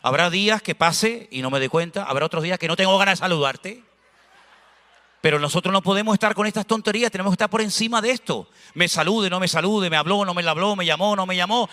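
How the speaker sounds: ordinary talk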